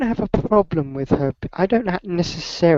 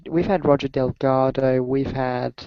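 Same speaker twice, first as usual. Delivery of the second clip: ordinary delivery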